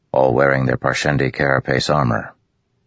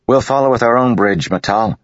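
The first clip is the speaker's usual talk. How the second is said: higher, louder call across the gap; calling back; 10 semitones higher, 5 dB louder than usual. A narrator's reading. Kaladin calling across to Matal, loud and raised. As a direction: project it to the officer. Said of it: "Kaladin called".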